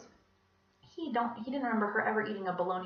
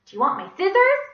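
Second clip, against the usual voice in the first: eager offer; 11 semitones higher, 14 dB louder than usual. A narrator's reading.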